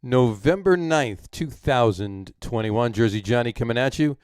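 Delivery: big, drawn-out podcast welcome; punchy host intro, loud and high at each start; show-opening announcer. Acted. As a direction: announce the show, stretching the key words.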